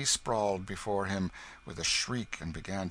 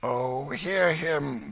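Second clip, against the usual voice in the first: slow, drawn-out higher lament; groaning; 7 semitones higher, 5 dB louder, much slower than usual. A narrator's reading. Hugo appealing to the stranger, groaning. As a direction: groan it out in feigned hurt. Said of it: "groaned Hugo".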